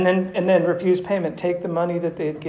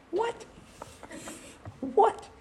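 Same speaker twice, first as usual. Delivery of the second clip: very high squeaked disbelief; incredulous; 7 dB quieter than usual. Acted. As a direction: react in stunned, high-pitched bafflement. Preacher acts stunned at Reuben's offer.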